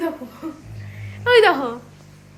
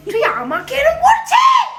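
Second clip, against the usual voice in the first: high, slow, loud mock outrage at the dog; mock offended; 8 semitones higher, 4 dB louder, slower than usual. Owner scolds the growling dog in mock offended outrage. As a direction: play the hurt owner, drawn out and loud.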